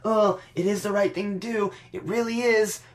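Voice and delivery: mock-agonized then laughing turn; hammed-up Bayley faking reluctance before laughing; Bayley impression. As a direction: sigh it out fake-torn, then snap into a giggly refusal. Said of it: "like she's".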